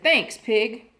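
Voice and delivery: a cheeky parrot mocking the girl; rude parrot. A narrator's reading. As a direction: toss the insult off lightly. Parrot snatches cookie rudely.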